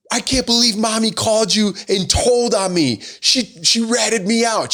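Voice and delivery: put-on high, whiny little-boy voice; little son. Dad imitating his son whining about being told on, high whiny kid voice. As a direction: play the kid who got told on. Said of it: "And he's like".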